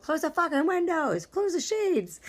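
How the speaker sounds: high, loud exasperated yell. Retold or imitated. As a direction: shout it across the room, high. Yelling at fiance about windows.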